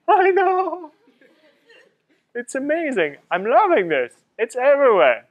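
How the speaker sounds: high, squealed "I know", then gushing; giddy delight. Retold or imitated. Replying to his amazed buddies, giddy and high-pitched.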